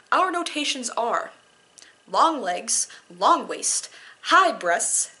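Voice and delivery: slow, heightened poetry reading; poem reading. Acted. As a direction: read it like a dramatic poem.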